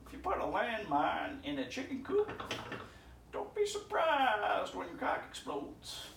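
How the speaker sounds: high-pitched impression of an ex-girlfriend's grandfather; imitated grandfather. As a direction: put on a high old grandpa voice, stretching out the punchline. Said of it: "The best thing about dating her was her grandfather".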